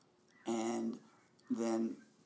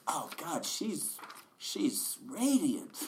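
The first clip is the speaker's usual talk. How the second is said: gushing inner narrator; 7 semitones higher, 4 dB louder, slower than usual. Retold or imitated. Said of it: "incessant voice in your head narrating".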